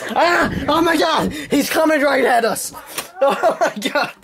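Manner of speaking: high panicked cries. Pretend. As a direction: yelp in fake terror. Mock panic at approaching kid.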